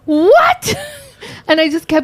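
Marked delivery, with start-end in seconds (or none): 0.0-0.8 very high, drawn-out incredulous squeak; high-pitched retold shock; mock disbelief. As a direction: act out baffled disbelief, pitched way up. Retold startled reaction to her accent.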